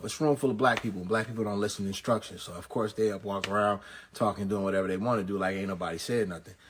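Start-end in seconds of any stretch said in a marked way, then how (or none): none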